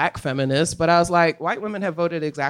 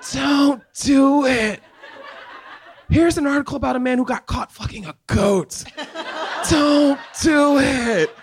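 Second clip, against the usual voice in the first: warning shout; 9 semitones higher, 4 dB louder than usual. Retold or imitated. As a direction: yell a warning over laughter. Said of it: "now I'm like".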